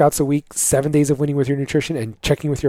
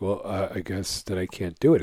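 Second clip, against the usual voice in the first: meek defeated husband; 5 semitones lower, 6 dB quieter than usual. Retold or imitated. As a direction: lower and softer, resigned and giving up. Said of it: "And guys are like".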